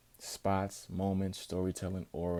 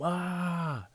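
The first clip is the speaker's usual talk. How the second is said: mock yell; 10 semitones higher than usual. Roman yelling at Punk.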